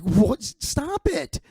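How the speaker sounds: high-pitched shocked mom, cutting him off; a mother's scandalised rebuke; mom scolding. Retold or imitated. Mom telling her brother off for his crude joke, high and shocked.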